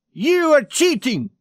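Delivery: high, loud outburst; shouted accusation. A narrator's reading. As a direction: burst out with a sudden angry accusation. Accusation bursting out mid card game.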